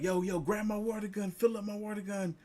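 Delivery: mock hyped-up dad, high and fast at the end; excited dad rallying for a water fight. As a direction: egg on the crew like a hyped dad. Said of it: "he's like".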